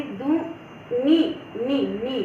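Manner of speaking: दू... नी, नी sounded out slowly syllable by syllable; syllables drawn out. Shown as a demonstration. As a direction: model दू नी carefully for the class. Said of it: "आप उच्चारण करिए".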